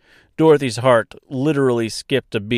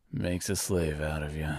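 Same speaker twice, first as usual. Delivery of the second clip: dying Tin Woodman; 2 semitones lower than usual. Acted.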